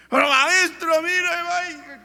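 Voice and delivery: high, frantic cry to the master; terrified disciples crying out; panicked plea. Retold or imitated. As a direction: beg in terror as the boat sinks.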